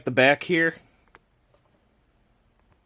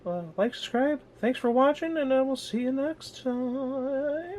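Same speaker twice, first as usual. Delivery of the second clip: high, squeaky cartoon outro voice; 13 semitones higher than usual. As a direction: sign off in a squeaky little voice.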